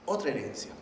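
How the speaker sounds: normal talking voice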